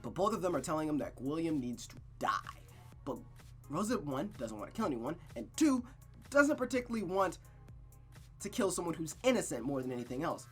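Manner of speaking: ordinary talk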